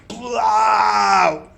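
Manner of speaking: loud, high mock sound of a blade bursting through; hammed-up gory stab sound; loud gory splat. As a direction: spit out the gore noise with relish. Sword driven through the ogre.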